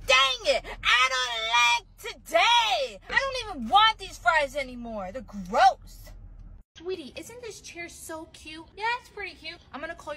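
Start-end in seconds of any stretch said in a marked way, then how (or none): 0.1-5.1 loud, whiny mock tantrum with a wail; petulant wailing outburst; tantrum shout. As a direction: throw a childish fit, yelling. Fries trade refused, tantrum.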